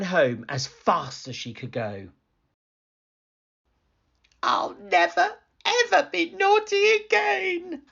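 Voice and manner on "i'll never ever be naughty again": put-on very high little-girl wail; wailing Goldilocks. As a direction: vow it in a high, panicked squeal. Frightened Goldilocks vows to behave.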